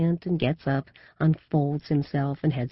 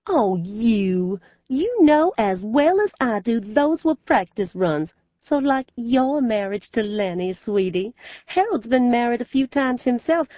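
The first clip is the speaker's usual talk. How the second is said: teasing southern belle; 8 semitones higher, 4 dB louder than usual. A narrator's reading. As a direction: needle her daughter sweetly. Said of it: "She laughs in a mean way".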